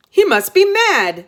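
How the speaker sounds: very high, loud monster voice; mocking dragon head. Dragon Snaggle mocking the boy, very high loud monster voice. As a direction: cackle it out like a monster. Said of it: "said Snaggle".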